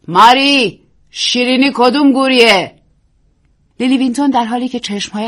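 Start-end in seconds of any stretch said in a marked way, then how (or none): 0.1-2.7 loud call down the hallway. Acted. Hostess shouts to maid Mary down the hall. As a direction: call the maid from the other room. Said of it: "با صدای بلند گفت".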